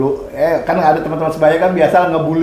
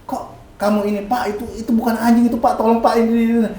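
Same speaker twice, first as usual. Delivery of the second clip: retold urgent appeal; 8 semitones higher, faster than usual. Acting out appeal to father.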